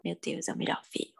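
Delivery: soft, voiceless mock gushing; hushed mock awe. Podcast guest imagining her reaction to a German film, hushed mock awe. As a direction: drop to a hushed, pretend film-fan rave.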